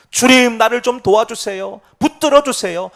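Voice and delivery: loud, high plea to the Lord; acted desperate shout in prayer; crying out. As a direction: call out urgently for help. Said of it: "주님께 들어달라고 지금 외치는 겁니다".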